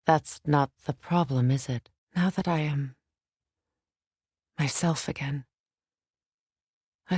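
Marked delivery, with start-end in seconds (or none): none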